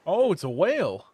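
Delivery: loud sudden surprise; surprised shout. Real, not acted. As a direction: blurt it out, startled and loud. Sudden whale pops up.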